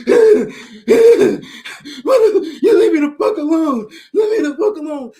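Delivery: loud, slow, sobbing yell; high crying shout, dragged out slowly. Retold or imitated. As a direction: wail it through tears. Richard crying his eyes out.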